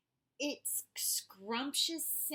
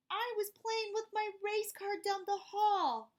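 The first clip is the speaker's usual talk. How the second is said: put-on little boy; 11 semitones higher, 5 dB louder than usual. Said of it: "the little boy replied".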